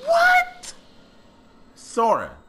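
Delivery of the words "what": high, loud, disbelieving shouts; shocked yelling. Real, not acted. Gamer yelling after the boss hits him again. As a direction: scream at the screen in disbelief.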